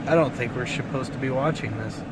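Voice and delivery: normal talking voice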